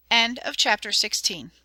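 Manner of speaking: ordinary delivery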